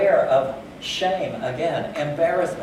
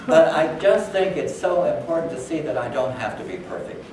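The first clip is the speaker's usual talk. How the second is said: usual speaking voice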